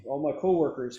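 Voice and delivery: usual speaking voice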